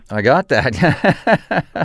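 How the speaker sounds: slow, laughing reply. Real, not acted. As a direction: say it through a laugh.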